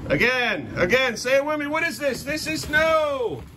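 rising high into a drawn-out call; drilling shout. Real, not acted. Parent making child recite the rule, drilling shout. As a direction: demand the answer, pitch climbing.

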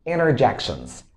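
interjections with the T dropped, "innerjections"; American reduced T. Shown as a demonstration. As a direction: say interjections as "innerjections", falling at the end. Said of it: "You can pronounce it this way".

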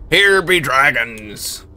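slow pirate-ish Hagrid voice; Hagrid impression. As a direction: drag it out like a pirate. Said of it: "You sounded more like a pirate".

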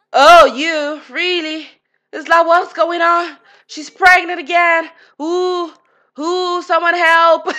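a gamer thrown into joking panic; mock panic. Real, not acted. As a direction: squeal for help in playful panic. Second pregnancy shock.

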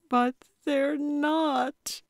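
high, slow, tearful whimper; slow teary plea; blubbering. A narrator's reading. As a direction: blubber it out as a frightened young woman. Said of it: "Anna blubbered".

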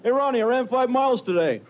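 imitating a boastful friend, voice lifted; lightly mock a friend showing off; mock bragging. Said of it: "bragging about their exercise".

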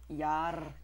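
badly faked Scottish pirate growl; a hammy Scottish pirate "yar"; bad Scottish accent. Player mocking Scottish pirate-looking guy, bad Scottish accent. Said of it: "That was the worst Scottish accent".

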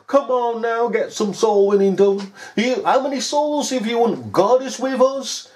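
high mocking revivalist voice; hammed-up boastful churchgoer; mocking preacher. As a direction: lampoon an eager soul-winner, pitched high.

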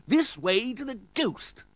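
high, slow lord's voice reading a sign; sign announced grandly. Acted. Lord imagining his ghost sign, high, drawn-out proclamation. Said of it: "with a sign saying".

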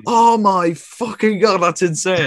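slow, high-pitched, drawn-out amazement; retold ecstasy. Runner learning he has won a medal, retold ecstatic disbelief. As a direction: re-enact overjoyed disbelief, stretched out high. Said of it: "I remember just being absolutely fucking ecstatic".

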